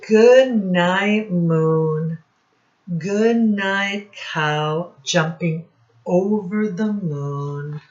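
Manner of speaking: loud, sing-song storybook reading; reading to children. Acted. Teacher reading Goodnight Moon aloud, sing-song. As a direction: read each goodnight out to the class. Reading picture book to class.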